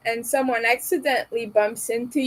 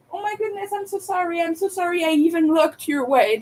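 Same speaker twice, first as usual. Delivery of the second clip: mock overapologizer; 6 semitones higher, faster than usual. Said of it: "when you say".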